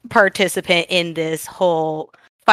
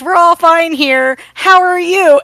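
higher, louder, fake-casual bluff; mock Han bluffing; 8 semitones higher, 5 dB louder than usual. Han bluffing the guard over the intercom, high fake cheer. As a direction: sell an awkward cheery cover story. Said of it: "and he goes".

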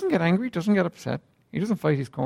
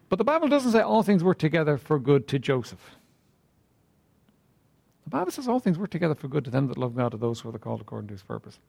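ordinary talk

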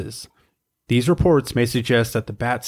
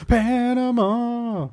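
drawn-out Panama; 12 semitones higher, much slower than usual. Real, not acted. Excited reaction to cruise idea.

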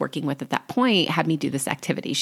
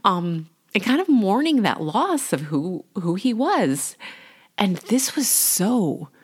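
getting emotional; 4 semitones higher, slower than usual. Real, not acted. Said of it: "I'm gonna get emotional".

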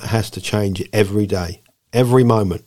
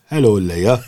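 put-on Welsh accent. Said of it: "my Welsh Jesus".

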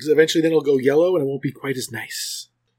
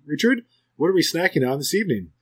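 ordinary delivery

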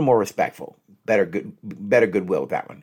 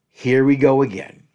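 frustrated; 3 semitones higher, 6 dB louder than usual. Shown as a demonstration. Coach voices someone seeing the cycle return, loudly frustrated. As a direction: let frustration push the volume up. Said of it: "person who's frustrated".

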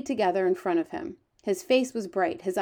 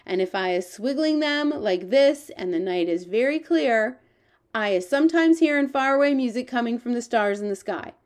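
higher-pitched storybook giant with quaint grammar; lively, raised giant character voice; eager, boastful giant; 3 semitones higher, 5 dB louder than usual. Acted. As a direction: play the friendly giant, proud of his hearing. The giant's lines in a read story.

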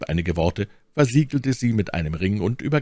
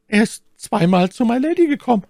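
very high, loud maid's voice; aufgeregte Ketty; 7 dB louder than usual. Acted. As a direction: report it anxiously in a high voice. Anxious maid reports to the men. (aufgeregte, hohe Mädchenstimme)

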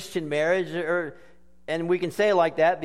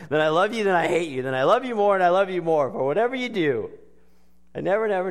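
higher, louder overblown recital; mock passionate; 5 semitones higher, 5 dB louder, faster than usual. Preacher overplaying the duet for laughs. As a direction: ham up the lovers' swoon.

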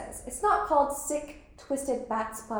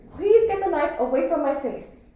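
raised, pleading in fear; frightened plea; 5 semitones higher, 9 dB louder than usual. Acted. Girl pleading with a boy holding a knife, frightened. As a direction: beg him to move the knife. Knife near her face.

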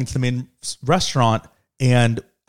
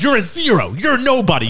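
high-pitched mock taunt; scornful retort, acted out high; retold put-down; 8 semitones higher than usual. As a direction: imitate dad dismissing a stranger. Imitating dad insulting the woman.

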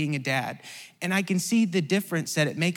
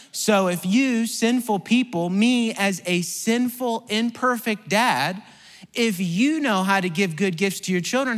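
scripture reading; 6 semitones higher, 5 dB louder than usual. Acted. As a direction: lean into each phrase of the verse. Quoting scripture in sermon.

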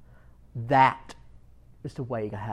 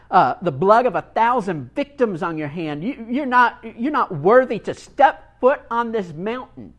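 scornful rant, rising higher and louder; contemptuous monk; 5 semitones higher, 7 dB louder than usual. Acted. Monk berating the samurai who climbed his mountain, scornful and loud. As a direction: scold the brute with open contempt.

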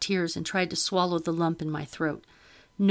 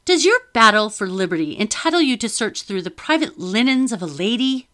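higher, louder affronted lady; indignant lady; 4 semitones higher, 8 dB louder than usual. A narrator's reading. Lady rebuking the officer: loud affronted challenge. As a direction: scold him with wounded pride.

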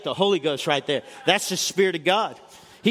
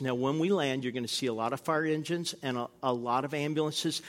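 calm pilot; 6 semitones lower, 9 dB quieter than usual. Retold or imitated. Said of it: "He says".

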